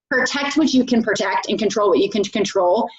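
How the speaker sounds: normal talking voice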